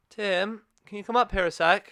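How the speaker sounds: imitating mum calling upstairs, strained. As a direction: mom calling dad upstairs with forced calm. Mum calls dad upstairs.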